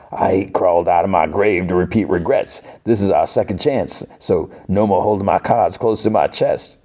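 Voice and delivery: badly faked Cajun accent; Gambit. Gambit woos Rogue, terrible hammy Cajun accent. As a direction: ham up a Cajun lover's speech. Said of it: "the worst Gambit accent ever".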